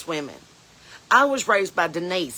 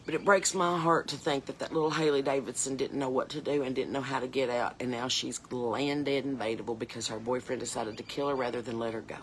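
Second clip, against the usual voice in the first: softer and lower, close to tears, fading out; tearful; 7 semitones lower, 7 dB quieter than usual. Real, not acted. Speaker mourning Haley Davidson to her viewers, softer, lower and tearful. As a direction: let real grief pull the voice down and quiet. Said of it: "I'm sorry I'm getting upset".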